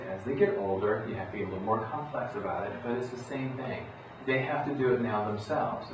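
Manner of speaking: ordinary talk, nothing marked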